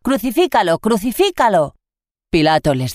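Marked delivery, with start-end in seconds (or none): 0.0-1.7 higher-pitched crowd cry, doubled; the crowd demanding it; read-aloud crowd yell; crowd shouting. A narrator's reading.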